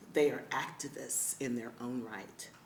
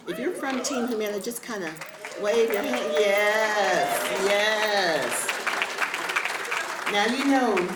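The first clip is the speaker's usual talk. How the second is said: higher and louder, fast call to the crowd; rally the group to wave; excited loud cheer to the audience; 6 semitones higher, 9 dB louder than usual. Real, not acted.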